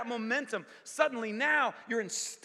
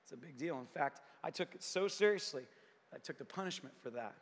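much softer, lower, gentle reassurance; tender, hushed Jesus; 7 semitones lower, 7 dB quieter than usual. Acted. Jesus reassures the preacher about his betrayal, hushed and tender. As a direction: comfort him like a loving father, softly. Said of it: "He said".

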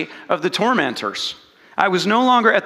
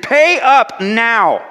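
slow, louder, higher threat; menacing demand; 4 semitones higher, 5 dB louder, much slower than usual. Acted. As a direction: drag each word out as a threat. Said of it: "seized him by the throat and demanded".

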